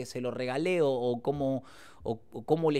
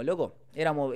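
normal talking voice

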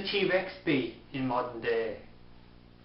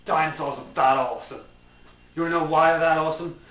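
ordinary delivery

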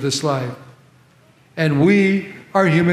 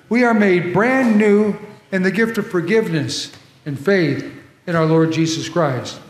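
normal talking voice

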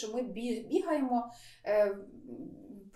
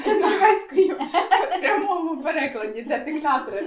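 laughing; 4 semitones higher, 9 dB louder than usual. Real, not acted. Co-host laughs at a literal translation, laughing loudly. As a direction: burst out laughing mid-sentence.

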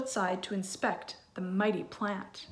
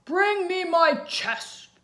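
higher, louder, slow giant roar; 8 semitones higher, 7 dB louder, slower than usual. Acted. Giant ordering his wife to fetch the chest, roaring. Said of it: "the giant's voice roared again".

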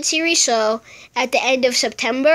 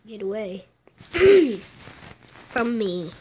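usual speaking voice

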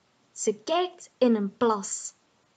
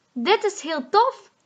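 high, eager cry of fun; excited call; 9 semitones higher than usual. Acted. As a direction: cry out with joy after the kick. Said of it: "roept Boe".

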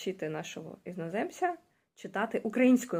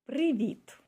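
teacher says "Привіт" slowly and clearly, pitched higher; model pronunciation; 6 semitones higher than usual. Shown as a demonstration. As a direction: demonstrate "Привіт" for the learner to repeat. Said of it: "You can start to read".

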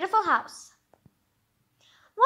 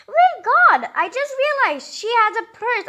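high, loud exclamation; sudden surprise; 7 semitones higher, 6 dB louder than usual. Real, not acted. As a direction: burst out on noticing something. Sudden realisation.

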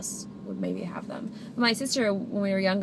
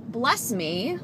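ordinary talk

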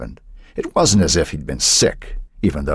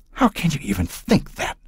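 high, breathy mother's voice, a touch of tremble; breathed, trembling; 12 semitones higher, 4 dB quieter than usual. Acted. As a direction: breathe it out in disbelief. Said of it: "she breathed, her voice trembling".

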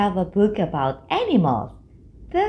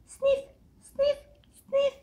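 high little mouse sniffs; sniffing the air; 5 dB quieter than usual. Acted. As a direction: sniff about like a tiny mouse. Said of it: "a mouse sniffing around to find".

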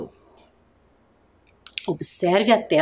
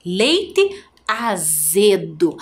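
leite azedo said slowly for dictation; slow and clear; much slower than usual. Shown as a demonstration. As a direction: spell it out slowly for the class. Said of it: "Observem lá o slide". (falado devagar para ditado)

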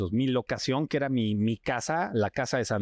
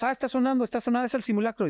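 nervous crew; 11 semitones higher, much faster than usual. Retold or imitated. Crew reacting to earthquake alarm.

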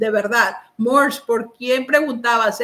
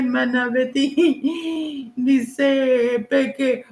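usual speaking voice

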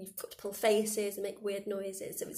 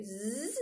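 fly buzz. Shown as a demonstration. Teacher runs through the fly buzz. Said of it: "make a zzz sound".

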